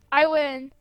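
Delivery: normal talking voice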